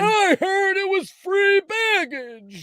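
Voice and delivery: put-on high, very slow old man voice. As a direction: quaver it out like a confused old man. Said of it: "my old man voice".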